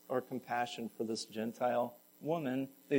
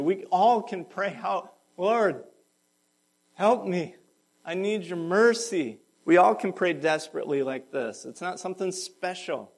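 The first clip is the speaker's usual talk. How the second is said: loud, high preaching voice; 4 semitones higher, 7 dB louder than usual. Acted. Pastor urging the congregation to pray, fervent. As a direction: drive the call home to the room. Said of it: "preaching at full force".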